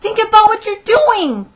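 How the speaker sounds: high, drawn-out shout; desperate cry. A narrator's reading. Teacher cries out at the mob wrecking her schoolroom. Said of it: "cried Miss Catherine".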